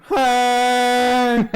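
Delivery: long, loud, high honk; school bus horn. Retold or imitated. Bus driving by mid-sentence, loud held honk. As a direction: blast one sustained note like a bus horn. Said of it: "like a school bus".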